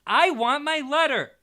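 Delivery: high, loud shout; Harry shouting at his uncle. A narrator's reading. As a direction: shout for your letter. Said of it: "he shouted".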